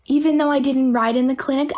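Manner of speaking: ordinary talk, nothing marked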